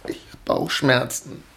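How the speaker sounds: put-on a child pleading he feels unwell; a hint of a whimpering small boy; whiny sick child. Boy fakes illness to mother.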